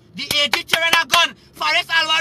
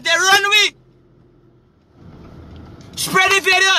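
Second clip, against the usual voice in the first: angry shout; 4 semitones higher than usual. Real, not acted. Angry rant on police.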